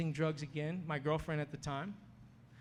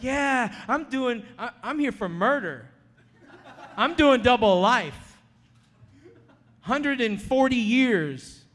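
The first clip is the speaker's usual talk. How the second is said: boasting inmate; 8 semitones higher, 11 dB louder than usual. Retold or imitated. Inmate boasting about his murder sentence.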